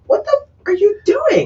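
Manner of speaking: very high mock shriek; imitated outraged woman. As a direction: squeal her outrage in imitation. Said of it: "she's like".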